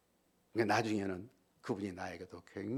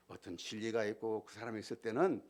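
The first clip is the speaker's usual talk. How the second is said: ordinary talk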